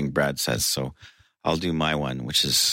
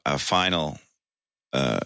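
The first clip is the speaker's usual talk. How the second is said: normal talking voice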